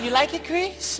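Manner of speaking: a hint of Michael's gentle question. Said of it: "He was like".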